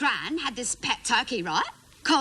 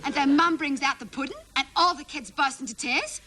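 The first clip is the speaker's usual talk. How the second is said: ordinary talk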